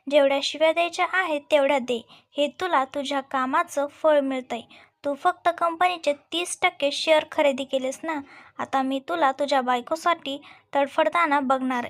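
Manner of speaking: ordinary delivery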